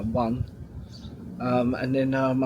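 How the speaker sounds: ordinary delivery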